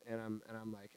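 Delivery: ordinary talk, nothing marked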